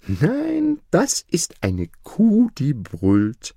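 put-on high, slow girl's voice; little girl correcting. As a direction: correct them like a child.

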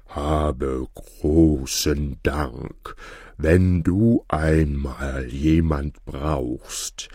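low, very slow ox voice; a big calm ox speaking. A narrator's reading.

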